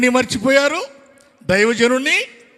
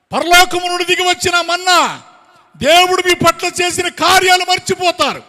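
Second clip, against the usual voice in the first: high, loud, urgent sermon cry; full-force preached warning; 8 semitones higher, 7 dB louder than usual. Acted. Preacher voices the warning against returning to Egypt, full-force preaching. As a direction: warn them in long ringing shouts.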